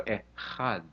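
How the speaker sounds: quiet, drawn-apart Echad with throaty ch; Echad split at ch. Shown as a demonstration. Coaching the audience to practise, Echad split at ch. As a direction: break Echad before the guttural ch. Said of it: "put it in the middle of that word".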